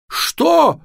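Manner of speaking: very high, loud yelp; horrified shriek of disbelief; screaming. A narrator's reading. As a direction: yell like someone being slaughtered. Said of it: "закричал, как резаный папа".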